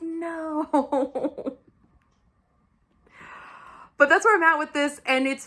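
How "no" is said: long, high mock wail; hammed-up anguished no. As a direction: stretch the word into a dismayed whine. Acted dismay over romance.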